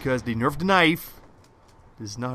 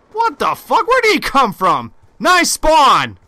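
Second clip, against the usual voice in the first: raging gamer; 13 semitones higher, 12 dB louder, slower than usual. Real, not acted.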